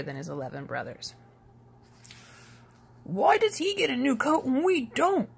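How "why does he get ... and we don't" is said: higher, grumbling brother voice; sulky older brother complaining; a jealous brother's grumble; grumbled. A narrator's reading. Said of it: "grumbled one brother".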